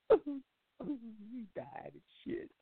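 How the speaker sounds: high, very slow blubbering whine; mock sobbing. Man tells his girl the dog died, mock sobbing. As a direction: drag it out like a weepy man. Crying man answers girlfriend.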